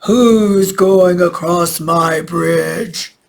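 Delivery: loud, very slow troll bellow; drawn-out storybook troll roar; shouting troll. A narrator's reading. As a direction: shout the challenge with every word stretched out. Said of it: "and shouted".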